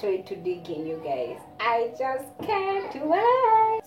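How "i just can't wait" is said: stretched high eager cry; excited drawn out. Real, not acted. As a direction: draw out the wait, thrilled.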